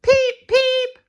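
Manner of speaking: very high loud peep peep; engine whistle. Acted. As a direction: toot like a little engine. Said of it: "there's Thomas going".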